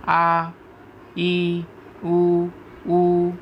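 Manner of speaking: આ, ઈ, ઉ, ઉ each held as a pure vowel; independent vowel demo. Shown as a demonstration. Teacher showing vowels stand alone, આ, ઈ, ઉ, ઉ held separately. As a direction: sustain આ, ઈ, ઉ, ઉ separately on a level note. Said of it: "સ્વરનું સ્વતંત્ર ઉચ્ચારણ થાય".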